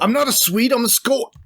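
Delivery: high-pitched mock Scottish protest; Scottish accent impression. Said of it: "the Scottish guy in Summer Rental".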